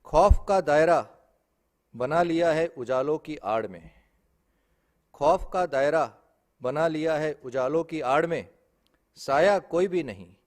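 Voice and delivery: loud, emphatic stage recitation; poetry recitation. Acted. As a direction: ring out each line, then let the last one drop. Recites poem, louder couplet. (मंच पर ज़ोरदार काव्य पाठ)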